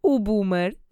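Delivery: laughing, half-mocking thought; amused thought. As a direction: chuckle through the word boomer. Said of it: "eu pensei".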